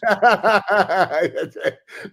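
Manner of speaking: loud high burst of laughter; real laugh. Real, not acted. Guest laughing hard at the host's first time sex joke.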